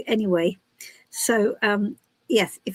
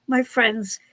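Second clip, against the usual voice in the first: normal talking voice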